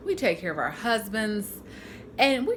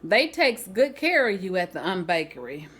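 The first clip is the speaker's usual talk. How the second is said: ordinary delivery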